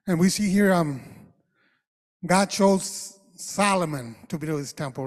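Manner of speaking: loud preaching opener. Acted. Pastor begins the sermon on Solomon, loud preaching.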